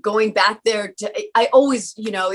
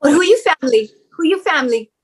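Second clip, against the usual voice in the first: higher, louder Hawaiian Pidgin auntie question; family asking in Hawaiian Pidgin, pitched up; 6 semitones higher, 4 dB louder, faster than usual. Acted. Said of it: "cracked out a little pidgin".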